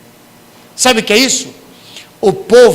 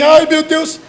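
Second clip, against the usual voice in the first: much higher wailing cry; desperate cry; 10 semitones higher, 4 dB louder, slower than usual. Retold or imitated. Sinner panicking at consequences, high mock wail. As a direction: wail to God in mock despair. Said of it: "Isso é desespero".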